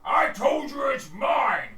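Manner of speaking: loud, high, drawn-out bellow; full-force acted shout; angry orc quarrel. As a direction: yell your claim at a rival orc. GM voices orcs quarrelling over kill.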